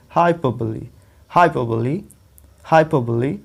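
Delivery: हाइपरबोली as hy-PUH-buh-lee, no r; four beats in हाइपरबोली, ending in lee; correct pronunciation. Shown as a demonstration. Teacher gives students the right form, हाइपरबोली as hy-PUH-buh-lee. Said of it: "प पे हमें रुकना है".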